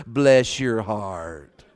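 low, soft, very drawn-out blessing; Southern sympathy. Acted. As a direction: stretch it out slowly in a hushed Southern drawl. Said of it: "Southerners have their own usage".

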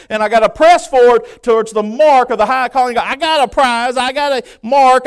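loud, high-pitched sermon delivery; full-force preaching. Real, not acted. Preacher urging church toward the mark, full-force preaching.

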